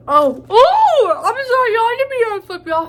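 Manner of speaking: high, loud flustered oh oh, then rushed sorry; startled apology. Real, not acted. Flustered apology to viewers after flipping them off. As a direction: catch yourself after an accidental rude gesture.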